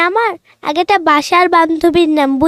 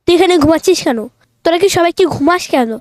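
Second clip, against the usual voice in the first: ordinary talk, nothing marked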